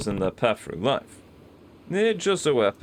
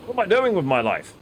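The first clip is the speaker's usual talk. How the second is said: exasperated; 5 semitones higher than usual. Acted. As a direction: throw up your hands in despair. Exasperated repeat of the lament.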